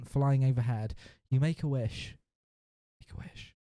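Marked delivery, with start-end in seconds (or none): 3.0-3.5 hushed, voiceless whisper. Pretend. Narrator echoes the dragon wish to the player, whispered. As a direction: whisper it like a secret echo. Whispered echo of story line.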